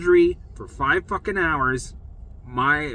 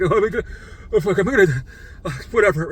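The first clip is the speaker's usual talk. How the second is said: retold panic; 5 semitones higher, 4 dB louder, faster than usual. Panicking before going under.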